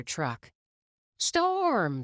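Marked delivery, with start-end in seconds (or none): 1.2-2.0 put-on eager kid calling out. A narrator's reading. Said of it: "said one young fan".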